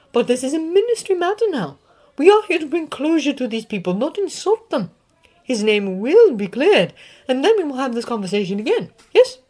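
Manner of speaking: put-on loud, high, tart old witch professor; McGonagall. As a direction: scoff at the fools in the Ministry. McGonagall insists on procedure.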